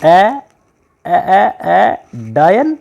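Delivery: high, startled, stammering in fear; frightened stammer. Acted. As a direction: gasp out the word in sudden fright. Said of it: "अचानक से डर गया और बोला". (डर से हकलाते हुए)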